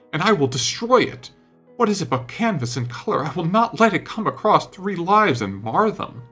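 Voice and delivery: high, heated declaration; agitated outburst. A narrator's reading. Painter vowing to destroy his finest work, agitated outburst.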